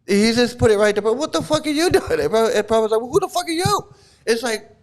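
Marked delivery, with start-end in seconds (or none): 1.1-2.2 high-pitched, indignant outburst; hammed-up irritation; mock mad. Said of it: "and he's getting mad". 3.0-3.9 very high, indignant comeback; hammed-up offended guru; mock mad. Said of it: "Prabhupada's getting mad".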